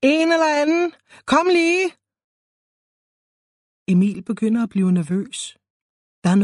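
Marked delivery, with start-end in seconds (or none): 0.0-1.9 high, louder call for help; nervous patient calling for someone. A narrator's reading. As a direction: call out higher and louder for anyone. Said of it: "Denne gang kalder han lidt højere".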